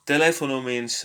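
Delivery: ordinary talk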